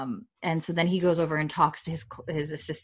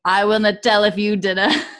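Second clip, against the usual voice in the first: laughing wry quip; 3 semitones higher, 8 dB louder than usual. Retold or imitated. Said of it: "chimes in".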